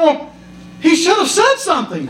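put-on high, matter-of-fact old-lady punchline; deadpan mom. Mom defending eating the parrot, high and innocently matter-of-fact. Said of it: "And she said".

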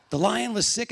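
ordinary delivery

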